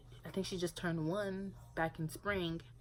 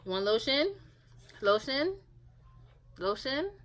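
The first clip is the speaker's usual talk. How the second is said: higher, louder coaxing questions, each rising; asking the baby; 7 semitones higher, 6 dB louder than usual. Shown as a demonstration. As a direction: coax the toddler to answer. Offering lotion to the baby.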